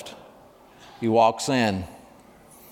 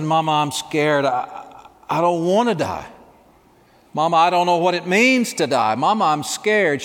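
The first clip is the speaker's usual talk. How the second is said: put-on scared little boy; 5 semitones higher than usual. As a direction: plead like a terrified little boy. Preacher voices dying boy's fear.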